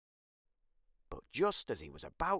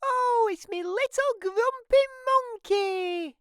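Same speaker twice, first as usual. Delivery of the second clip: very high, loud doting mum voice; mum's cry of joy; 6 dB louder than usual. Acted. Mum greeting Jim with a hug, very high and loud. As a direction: squeal it like a mother thrilled to see her son. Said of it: "Jim's mum cried".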